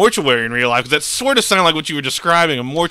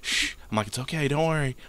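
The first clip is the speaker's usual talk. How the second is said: soothing hush; 3 semitones higher, 10 dB quieter than usual. Retold or imitated. Comforting crying old stepdad.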